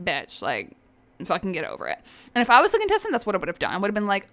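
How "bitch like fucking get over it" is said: low, soft dismissive mock voice; mock snappy. Host imagines contestant dismissing Brianna, low mock. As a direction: drop it low and brush her off. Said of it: "to be like".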